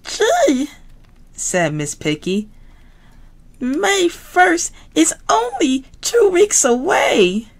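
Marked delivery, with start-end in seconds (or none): 0.0-0.7 very high squealy piggy voice; Miss Piggy voice. Acted. Miss Piggy to classmates, very high pig voice. 3.6-7.5 very high squealy piggy voice; Miss Piggy voice. Acted. Miss Piggy to classmates, very high pig voice.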